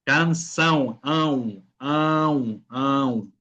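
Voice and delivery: "Canção", then its nasal ending "ão" drawn out alone; nasal vowel. Shown as a demonstration. Teacher drilling a student, nasal "ão" isolated after "Canção". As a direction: repeat "ão" through the nose. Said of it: "un sonido nasal".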